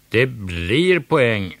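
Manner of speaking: slow loud protest; called out. A narrator's reading. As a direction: insist from the stands. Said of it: "ropade gamla Maja".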